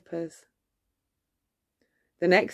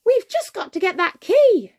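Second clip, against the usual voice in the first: high squeaky urgent mouse; 13 semitones higher, 6 dB louder than usual. A narrator's reading. Jack the mouse telling Gus, high and loud. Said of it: "Jack told Gus".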